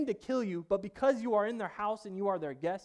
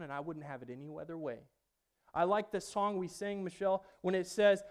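usual speaking voice

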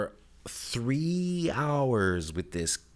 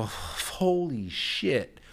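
drawn-out disbelief; 5 semitones higher, much slower than usual. Retold or imitated. Reacting to seatmate's reply.

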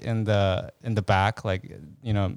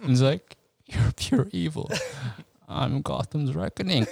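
Bane quote; 4 semitones higher than usual. Retold or imitated. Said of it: "Bane quote".

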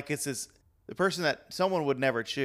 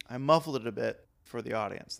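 ordinary delivery

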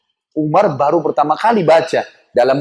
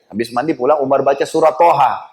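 normal talking voice

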